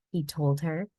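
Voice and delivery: usual speaking voice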